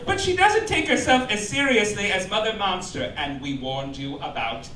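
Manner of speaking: usual speaking voice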